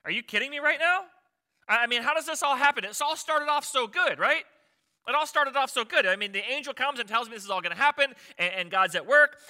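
freaking out, high and loud; Mary. Acted. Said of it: "Mary was freaking out".

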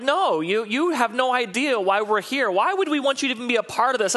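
high, huffy mock protest; offended couple. Couple rejects the pastor's counsel, high and huffy. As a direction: play the couple brushing off the pastor. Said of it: "they look at me like".

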